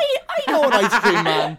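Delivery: giggling through words; laughing. Real, not acted. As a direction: laugh while bragging. Laughing while bragging.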